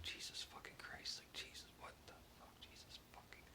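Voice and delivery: imitated whispered swearing, barely audible; muttering under breath. Old editor marking up a stranger's draft, muttering under his breath. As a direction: mutter it very softly to yourself, as if reading. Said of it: "he's muttering on, under his breath".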